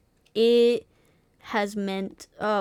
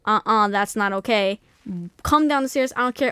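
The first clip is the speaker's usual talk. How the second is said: louder, raised-voice mock confrontation; retold defiant outburst; 5 dB louder than usual. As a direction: act out a kid standing up to Dad. Child confronting dad in retold story.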